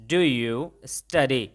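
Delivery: very slow, word by word; slow model sentence. Shown as a demonstration. Teacher shows students the English, very slowly. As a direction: spell it out slowly for learners. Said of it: "तो अंग्रेजी में कैसे बोला".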